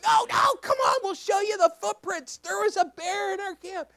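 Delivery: high, loud excited protest; imitated insistent kids. Junior highers urging pastor to look, excited imitation. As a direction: plead in a kid's high voice.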